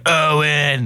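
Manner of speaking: mock yell, held back in volume; drawn-out mock holler; yelling woman. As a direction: imitate a woman hollering for someone. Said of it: "she's yelling the whole time".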